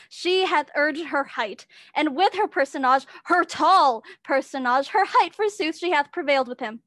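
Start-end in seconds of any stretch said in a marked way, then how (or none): none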